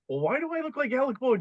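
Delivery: mock disbelief. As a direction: squeak it out in baffled outrage. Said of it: "I go".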